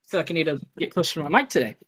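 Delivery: ordinary delivery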